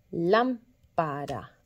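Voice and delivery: "lámpara" very slow, strong LÁM, then pa-ra; stress on LÁM. Shown as a demonstration. Teacher showing where the force is, "lámpara" with LÁM stressed. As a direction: punch the first beat of "lámpara", separate the rest. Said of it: "donde estaba la fuerza de la sílaba".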